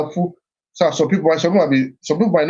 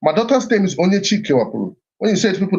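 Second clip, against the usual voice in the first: normal talking voice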